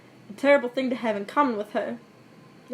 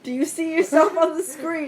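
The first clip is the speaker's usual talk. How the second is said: raised, slow exasperation; exasperated; 5 semitones higher, 4 dB louder than usual. Real, not acted. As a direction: scold slowly, higher and louder. Mock scolding co-reader mid-game.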